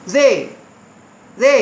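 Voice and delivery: "जे" with a voiced th, American accent. Shown as a demonstration. Teacher shows "like this", American-accent "जे". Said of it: "like this".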